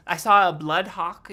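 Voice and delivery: excited shout about a hawk; loud NPC exclaim. Acted.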